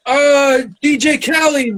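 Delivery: high, slow, shouted ring-announcer intro. Retold or imitated. Hosts introducing the rival beatboxer, hyped announcer shout. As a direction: boom it out like a battle MC. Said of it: "And we're like".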